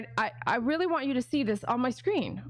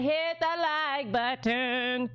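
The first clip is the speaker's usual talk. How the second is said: put-on slow, drawn-out Jamaican accent; much slower than usual. Quoting her in a Jamaican accent. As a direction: stretch it out Jamaican-style. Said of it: "She said".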